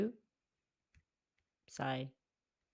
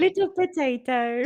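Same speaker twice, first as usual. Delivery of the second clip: teasing; 6 semitones higher, 10 dB louder than usual. Real, not acted. Teasing sibling.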